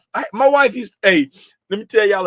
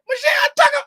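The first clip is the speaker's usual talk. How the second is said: mimicking Zay; faster than usual. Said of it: "and Zay was like".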